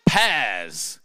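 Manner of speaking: mock toddler's loud demand; loud toddler announcement. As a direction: blurt out one word like a toddler. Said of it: "Asher very loudly said".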